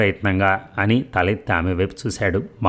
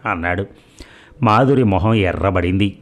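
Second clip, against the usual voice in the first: ordinary delivery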